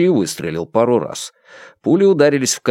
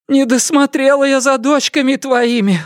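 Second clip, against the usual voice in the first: high, wailing self-reproach; lamenting; 14 semitones higher than usual. A narrator's reading. Mother confessing failure to son-in-law, high lament. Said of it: "вновь запричитала матушка".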